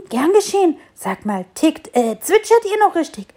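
high, loud outraged protest. A narrator's reading. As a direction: scold the birds, flabbergasted. Tree outraged at birds.

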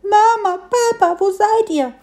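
high, loud, lost little piglet crying for its parents; plaintive whimper. A narrator's reading. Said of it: "Wimmerte es ganz kläglich".

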